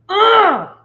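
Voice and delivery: a sudden high, loud squeal of joy; high excited yelp. Real, not acted. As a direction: let the excitement burst out as a shriek. Bursting with excitement.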